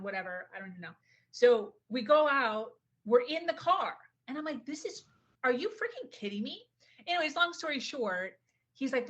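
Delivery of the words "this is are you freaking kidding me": high, incredulous mock outburst; retold incredulity. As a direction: act out can't-believe-it shock, pitched high. Retells disbelief inside the car.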